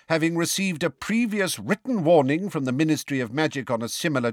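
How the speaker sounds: high, declaiming official voice; reading out charges. A narrator's reading. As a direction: read the charges out formally, pitched high. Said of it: "and read out".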